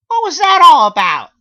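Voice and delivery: very high, loud squeal; cartoon squeal of confusion; squeaky narrator voice. A narrator's reading. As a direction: shriek the question. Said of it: "I asked him".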